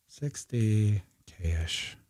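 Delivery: slow, soft and stretched; drawn-out murmur. Real, not acted. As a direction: murmur it slowly while busy. Typing in the label slowly.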